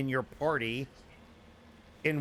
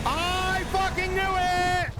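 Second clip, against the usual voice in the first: high, drawn-out scream; frantic shout; 5 dB louder, much slower than usual. Real, not acted. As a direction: yell it out in panic. Thresher Maw ambush, screaming.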